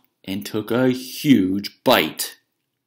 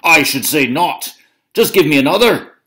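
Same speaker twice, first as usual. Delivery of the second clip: loud, higher bravado; blustering shout; 7 semitones higher, 9 dB louder than usual. Acted. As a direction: demand another loaf, roaring. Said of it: "shouted Cucullin".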